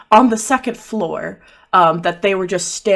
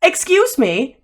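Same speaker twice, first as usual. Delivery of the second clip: affronted; 10 semitones higher, slower than usual. Acted. [name] objects to being interrupted.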